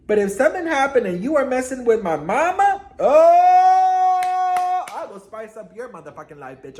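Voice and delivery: hammed-up warning that bursts into a high "oh"; playfully fired-up defensiveness; mock threat. Mock threat over messing with mama.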